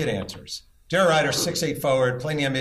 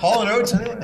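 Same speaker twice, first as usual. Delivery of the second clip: mock plea; 9 semitones higher, 5 dB louder than usual. Begging the crowd for recognition.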